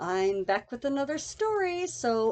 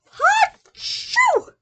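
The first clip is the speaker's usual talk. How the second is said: big sneeze; 9 dB louder than usual. Acted. Bear sneezes.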